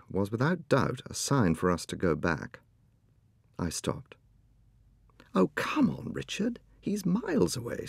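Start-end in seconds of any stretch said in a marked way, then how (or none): 5.3-7.9 urgent, high hushed whisper; wife hurrying her husband on, impatient whisper. Acted. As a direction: whisper impatiently, urging him on. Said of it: "said Janet impatiently".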